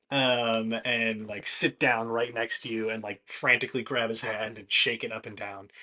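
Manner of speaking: ordinary delivery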